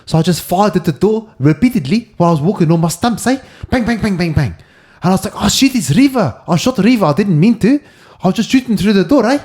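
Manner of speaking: mock Pistorius in a South African accent; South African accent, lightly done. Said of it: "It's not a bad accent, that".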